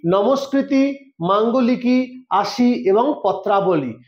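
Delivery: each name spelled out slowly; teacher listing names slowly for dictation. Shown as a demonstration. Teacher dictates the four types slowly to students. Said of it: "Nandi কয় প্রকার".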